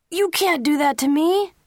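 high, loud cry of protest; upset protest. A narrator's reading. Son protesting to his parents, a high loud upset cry. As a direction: shout it out like a teen who can't believe it.

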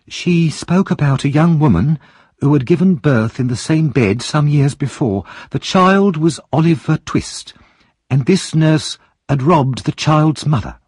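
high, clear woman's voice; a bold woman telling a secret; Mrs Bumble. Acted. Mrs Bumble tells her story.

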